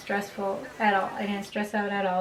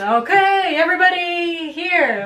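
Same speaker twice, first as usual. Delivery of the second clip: high, loud announcer call; loud projecting voice; 9 semitones higher, 8 dB louder, slower than usual. Retold or imitated. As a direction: project it across the crowd. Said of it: "a very loud projecting voice".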